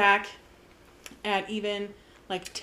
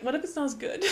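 nervous laugh through the words; laughing worry; 4 semitones higher, 4 dB quieter, faster than usual. Retold or imitated. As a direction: laugh it out, half worried. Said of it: "a part of me is like".

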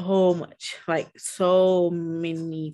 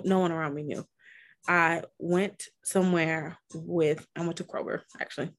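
normal talking voice

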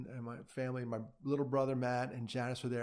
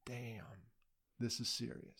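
much softer, stunned aside; hushed shock; 2 semitones lower, 8 dB quieter than usual. Retold or imitated. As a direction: say it under your breath. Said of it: "And I'm like".

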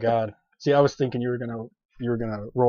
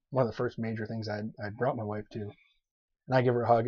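normal talking voice

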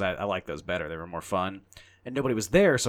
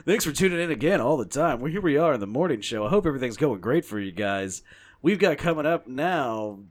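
upbeat put-on morning-show host; hammed-up, higher announcer lilt; radio DJ voice; 4 semitones higher, 4 dB louder than usual. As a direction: swing through it like FM patter. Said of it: "I was clearly doing a radio voice".

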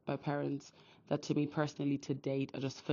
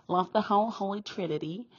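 bursting out higher and louder with joy; gushing love; 7 semitones higher, 9 dB louder than usual. Real, not acted. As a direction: let the love for the Trinity spill over, loud and high. Gushing list of loving God.

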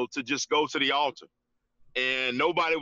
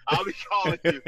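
the repeat slides into a laugh; laughing tease; 3 semitones higher, faster than usual. Real, not acted. To the host about a future baby girl, laughing tease.

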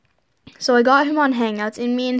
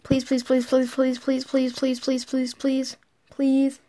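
please after please in a quick, even chant; rapid-fire pleading; 3 dB quieter than usual. Retold or imitated. Friend begging in a message read aloud, rapid-fire pleading. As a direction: read out the repeated pleas like a machine gun.

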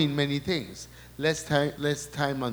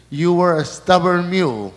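loud and laughing, pitch up; laughing, louder and higher; 5 semitones higher, 10 dB louder than usual. Real, not acted. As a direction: burst out laughing at the line. Relays Jesus teasing, laughing.

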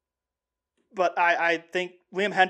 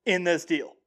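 ordinary delivery

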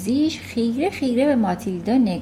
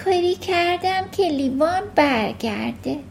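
put-on lightly raised little-girl voice, earnest; a young girl owning up, higher; Matilda's voice; 7 semitones higher than usual. A narrator's reading. Matilda admits to her teacher, lightly voiced girl.

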